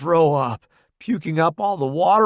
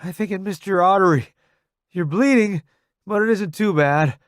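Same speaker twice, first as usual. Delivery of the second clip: reassuring; 7 semitones higher, 5 dB louder than usual. Acted. Narrator reassuring wounded friend.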